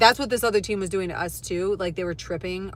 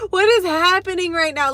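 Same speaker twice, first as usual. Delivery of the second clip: higher and louder, incredulous exclamation; disbelief; 8 semitones higher, 6 dB louder than usual. Real, not acted. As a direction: throw it out in full astonishment. Stunned by parents fighting.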